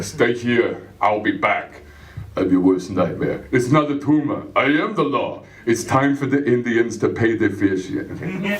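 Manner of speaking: deadpan Schwarzenegger one-liners; lightly done Schwarzenegger voice; Schwarzenegger catchphrases. Acted. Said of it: "start spouting catchphrases".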